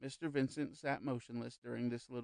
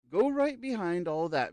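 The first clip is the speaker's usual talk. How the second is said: raised, higher and louder urging; voice rising; 6 semitones higher, 8 dB louder than usual. A narrator's reading. Medium urging the young man onward, voice raised. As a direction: lift the voice into an emphatic push. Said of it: "his voice rose a little".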